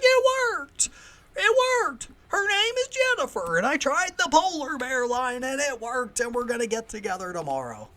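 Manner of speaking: very high, loud excited shouting, easing down; Wild Bill overjoyed. Retold or imitated. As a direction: imitate an oddball bursting with triumphant news. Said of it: "I'm doing a Wild Bill impression".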